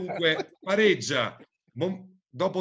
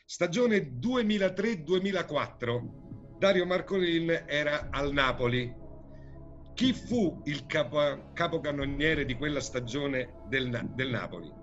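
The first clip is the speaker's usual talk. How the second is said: showy game show host. Acted. Quiz host reading questions.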